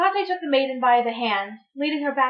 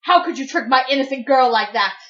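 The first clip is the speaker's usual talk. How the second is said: louder, accusing demand; angry accusation; 6 dB louder than usual. Acted. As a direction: confront him in anger. Mother confronts Hades.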